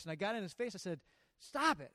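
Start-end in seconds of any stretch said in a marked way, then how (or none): 1.4-1.9 sharp, loud, high stern command; mean voice. Retold or imitated. To a swearing teenager, a loud mean stop. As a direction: snap him out of his tantrum. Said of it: "I got my mean voice".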